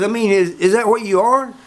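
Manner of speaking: high-pitched, puzzled double-check; incredulous question. Retold or imitated. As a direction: imitate someone struggling to believe the answer. Acted disbelieving question.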